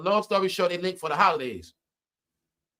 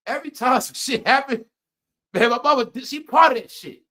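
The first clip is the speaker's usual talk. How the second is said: higher and louder, building frustration; exasperated rant; 6 semitones higher, 5 dB louder than usual. Retold or imitated. Complaining that his mother is behind every setback, louder and higher. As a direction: vent like it's all her fault.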